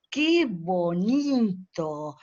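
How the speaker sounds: "¡Qué bonito!" muy lento, con la melodía estirada; entonación exagerada. Shown as a demonstration. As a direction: como hablándole a un bebé, exagerando la curva. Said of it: "exageran la entonación". ("¡Qué bonito!" cantadito y lento, como a un bebé)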